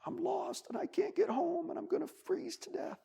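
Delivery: very high, small frightened voice; a tiny lost mouse; baby mouse voice. Acted. Baby field mouse tells the bunny it is lost, high and small. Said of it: "field mouse said".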